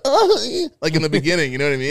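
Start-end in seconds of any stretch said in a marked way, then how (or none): 0.1-0.7 high, loud uh; sharp high tic yelp; imitated tic noise. Shown as a demonstration. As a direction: blurt a sudden squeaky uh. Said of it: "everyone would forget the".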